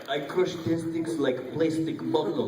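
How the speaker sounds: slow, heavy mock Russian accent; slow comic Russian accent. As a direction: lay on a thick Russian accent. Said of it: "you have to go Russian".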